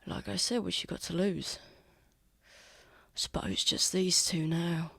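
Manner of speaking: hushed villain, half whispering; soft, half-whispered taunt; quiet threat. Acted. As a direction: keep the threat soft beside sleepers. Assassin quietly threatens the sleeping pair.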